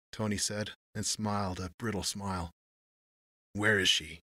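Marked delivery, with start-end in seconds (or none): 3.5-4.2 harsh. A narrator's reading. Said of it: "Johnny Rails asked harshly".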